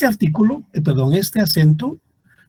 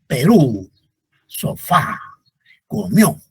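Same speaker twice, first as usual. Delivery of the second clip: Perú, sofá, comió, each hit on its last syllable; final-syllable stress; slower than usual. Shown as a demonstration. Teacher giving examples of palabras agudas, stressing the ends of Perú, sofá, comió. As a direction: punch the endings of Perú, sofá, comió, one at a time. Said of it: "en la última sílaba".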